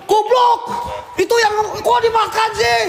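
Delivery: high-pitched, shocked yell; alarmed outburst. Real, not acted. Ghost hunter seeing something eaten, high alarmed shout. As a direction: cry out in horrified disbelief.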